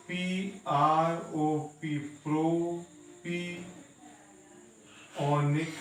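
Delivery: very slow: P R O P spelled letter by letter, then प्रोपियोनिक stretched out; spelled then sounded out. Shown as a demonstration. Teacher repeating the name for students, very slow. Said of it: "फिर से एक बार".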